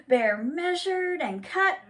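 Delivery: ordinary delivery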